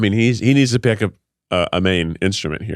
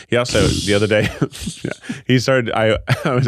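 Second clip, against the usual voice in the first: ordinary delivery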